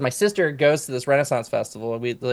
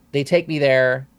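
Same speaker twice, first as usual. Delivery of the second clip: ordinary talk, nothing marked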